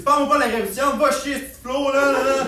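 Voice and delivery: loud, high acted outburst; dad blowing up at his son; father flying off the handle. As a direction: cut him off in a loud tirade. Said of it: "Là, il part en peur".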